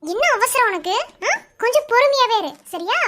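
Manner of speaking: loud, irritated telling-off; scolding. Acted. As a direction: snap back and demand some patience. Scolds back, telling to be patient. (அதட்டலாக, சத்தமாக)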